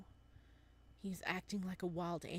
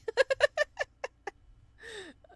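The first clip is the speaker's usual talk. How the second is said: loud high laugh; 13 dB louder than usual. Real, not acted. Big laugh.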